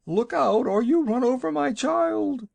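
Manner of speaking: high, loud panicked cry; frantic warning. A narrator's reading. Mother shrieks at the wagon over her child.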